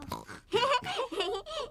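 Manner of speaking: put-on high child's giggle; light, high laughter; happy giggling. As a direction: giggle happily, pitched high. Children celebrate the repair.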